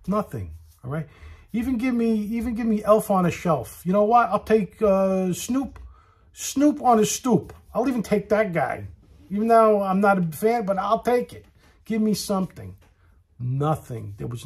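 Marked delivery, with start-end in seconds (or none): 1.5-12.8 high, loud pleading rant; exasperated rant. Real, not acted. Comic ranting to the crowd about the bare restaurant, loud. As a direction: beg for any decoration at all.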